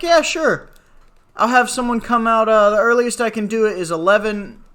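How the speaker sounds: mock cheerful agent; imitating the rep. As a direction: mimic a breezy agent brushing it off. Voicing the rep.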